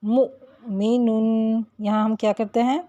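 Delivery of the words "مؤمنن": مؤمنن drawn out slowly, voice stopped on the hamza; Quranic recitation demo. Shown as a demonstration. Teacher reciting مؤمنن slowly as an example. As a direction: clip the hamza in مؤمنن with a jolt. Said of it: "اسی طرح".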